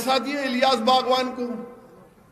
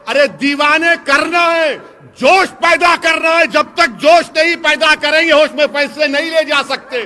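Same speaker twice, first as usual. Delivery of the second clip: loud, high, stirring rally cry; fiery shouting oratory; 5 semitones higher, 7 dB louder than usual. Acted. Speaker demanding passion from the crowd, shouting high and loud. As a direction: shake the crowd with your voice.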